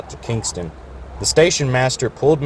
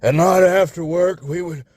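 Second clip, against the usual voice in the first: put-on higher, reminiscing old-timer; rambling old engine; 6 semitones higher than usual. A narrator's reading. Florida rambling about stargazing, old and fading. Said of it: "rambling to no one in particular".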